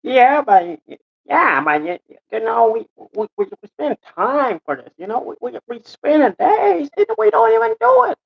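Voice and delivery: high, stammering protest; agitated Scott. Acted. Scott protesting the days they spend, agitated.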